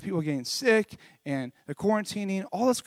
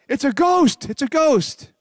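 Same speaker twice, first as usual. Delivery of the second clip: higher, louder cry of alarm; mock alarm; 9 semitones higher, 7 dB louder, slower than usual. Disciples spotting Jesus on the sea, mock alarmed cry. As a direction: call out like startled disciples. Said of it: "and they're like".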